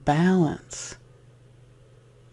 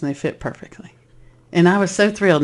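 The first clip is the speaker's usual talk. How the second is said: ordinary talk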